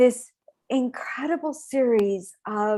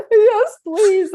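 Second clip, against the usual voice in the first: high, loud, teary "yes, please"; a pitched-up, half-sobbing thank-you; retold tearful yes; 10 semitones higher, 8 dB louder than usual. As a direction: replay yourself crying on the plane. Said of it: "And I'm crying".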